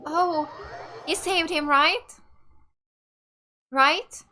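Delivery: ordinary talk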